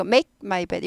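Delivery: 끊어서 또박또박 make my bed; staccato. Shown as a demonstration. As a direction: make my bed, each word clipped apart. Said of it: "이것도 스타카토처럼 되죠?". (스타카토로 끊어 읽기)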